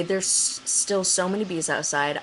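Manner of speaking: ordinary delivery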